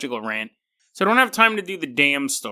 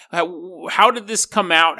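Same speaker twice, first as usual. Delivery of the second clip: normal talking voice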